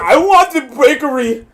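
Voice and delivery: loud, high mock demand; mock imitation. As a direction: parrot his demand, hammed-up. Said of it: "this guy's like".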